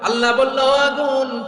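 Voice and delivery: loud, high preacher voicing God's order; thundering divine command. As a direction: command the fire at full force.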